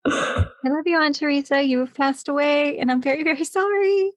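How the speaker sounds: tender words with a giggle underneath; mock condolence. Apologizing to her late aunt before the story, mock-solemn.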